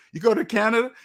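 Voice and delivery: normal talking voice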